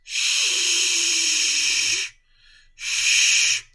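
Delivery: "shh" largo, puro aire sin voz; sonido de SH. Shown as a demonstration. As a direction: sostener "shh" fuerte, sin vibrar las cuerdas vocales. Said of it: "El sonido de la S H es". (demostración del sonido "shh", siseo sostenido)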